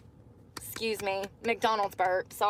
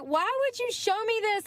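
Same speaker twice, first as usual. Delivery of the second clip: put-on upset kid; 12 semitones higher than usual. Upset kid answering angry mom.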